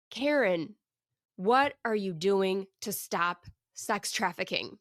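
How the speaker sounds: calm, weighty question with space between phrases; voice of God. Retold or imitated. God confronts a woman at a gala dinner, slow divine voice. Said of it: "I hear this voice".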